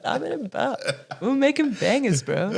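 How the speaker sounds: high, laughing surfer-bro. Retold or imitated. Winemaker bragging about bangers, laughing. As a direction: say it through giggles like a cool bro. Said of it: "And bop, like".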